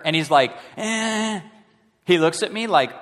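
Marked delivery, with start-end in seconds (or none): 0.8-1.4 put-on high, careless "eh" of a kid brushing it off; dismissive grunt. Son ignores dad again.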